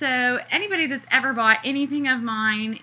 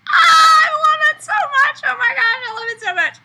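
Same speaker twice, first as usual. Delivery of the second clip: ecstatic shout; 11 dB louder than usual. Real, not acted. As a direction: shriek your love for it, overwhelmed. Delighted by app animation.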